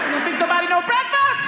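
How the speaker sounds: high, loud comic defiance; defiant shout. Retold or imitated. Son defying Mama in church, high loud shout. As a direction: holler it in mock indignation. Church quarrel with mother escalates.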